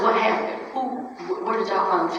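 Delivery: usual speaking voice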